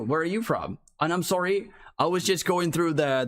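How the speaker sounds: ordinary talk, nothing marked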